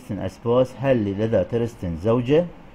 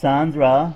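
"Sandra" louder and lifted; stress on Sandra; 3 semitones higher, 6 dB louder than usual. Shown as a demonstration. Teacher modelling a stressed name, "Sandra" loud. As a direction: hit the name "Sandra" strongly. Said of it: "ركزنا على ساندرا".

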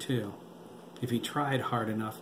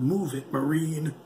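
a hint of a growl, raised and pushed; growled order; 4 semitones higher, 3 dB louder than usual. A narrator's reading. Adam ordering a Marine under fire, raised growl. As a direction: bark the order over gunfire. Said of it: "Adam growled".

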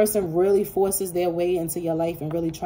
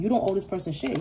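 usual speaking voice

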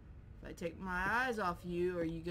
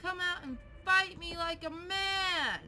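taunting challenge; 9 semitones higher than usual. Real, not acted. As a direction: call the hidden creature out to fight. Challenging hidden game creature.

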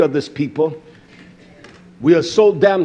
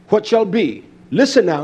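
ordinary delivery

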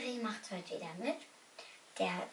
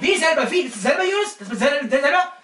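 loud, higher angry yell; acted outrage; 4 semitones higher, 17 dB louder than usual. Prankster confronting Jonas, loud acted anger. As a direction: fake fury at him.

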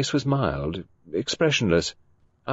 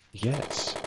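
soft, gentle yes; a gentle quiet yes; gently; 2 semitones higher, 7 dB quieter than usual. A narrator's reading. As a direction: ease it out kindly. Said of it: "said Poirot gently".

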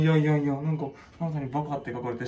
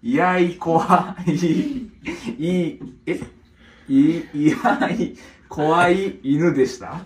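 loud, drawn-out words with puzzled breaks; halting read-aloud; 8 dB louder, much slower than usual. Real, not acted. Puzzling out the written story, loud and halting. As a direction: sound it out slowly and loudly.

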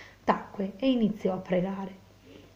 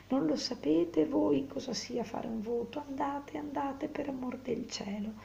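higher, softening plea; gentle pleading; 6 semitones higher than usual. A narrator's reading. Lucia begs Renzo to go away, gentle pleading.

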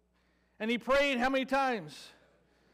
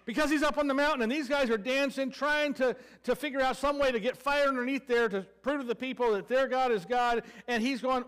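ordinary talk

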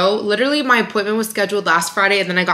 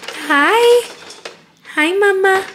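very high cooing greeting; 12 semitones higher, much slower than usual. Real, not acted. Greeting the baby.

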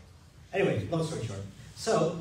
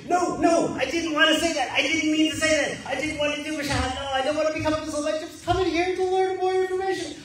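high, fast, panicked backpedal; mock panic; 11 semitones higher, 5 dB louder than usual. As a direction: scramble to take the words back. Panicked retraction.

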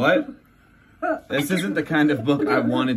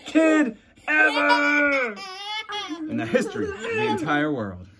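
gushing praise; 11 semitones higher than usual. Acted. Book praises the kid.

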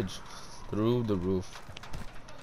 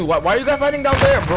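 frustrated shout; 12 semitones higher, 5 dB louder than usual. Real, not acted. Frustrated call to hiding teammates.

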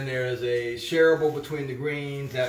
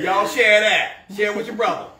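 mock bossy letter-writer; 8 semitones higher, 10 dB louder than usual. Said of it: "She's like".

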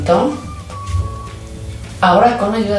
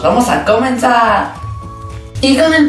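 cheerful slow kickoff; 7 semitones higher than usual. Real, not acted. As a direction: announce the start with glee. Kicking off the craft.

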